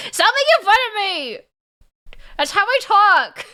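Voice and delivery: high, loud mock outrage; playful protest. Real, not acted. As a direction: yell it back, half laughing, offended. Protesting being mocked.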